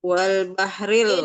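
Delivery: Wal bahril slowly and loud, with the l of bahril stressed; slow recitation demo. Shown as a demonstration. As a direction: bahril stretched out and clear. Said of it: "diulang, belum jelas tadi".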